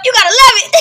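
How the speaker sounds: loud, high, breaking into laughter; laughing exclamation. Real, not acted. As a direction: shout it on the edge of a laugh. Cracks up at own militant bit.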